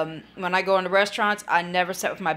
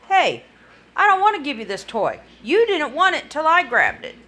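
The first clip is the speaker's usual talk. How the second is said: loud, firm and higher, standing her ground; speaking up loudly; 6 semitones higher, 5 dB louder than usual. Retold or imitated. Daughter telling the other girl off, loud and firm. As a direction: make the little girl hear you, loudly. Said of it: "Well, then tell her. Voice your opinion".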